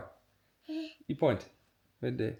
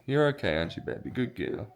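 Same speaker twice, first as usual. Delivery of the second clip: soothing dog; 4 semitones lower, 8 dB quieter than usual. Real, not acted.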